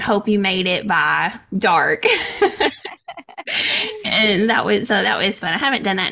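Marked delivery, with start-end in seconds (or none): 2.1-4.0 long high laugh; high warm laugh. Real, not acted. Laughing about floating till dark.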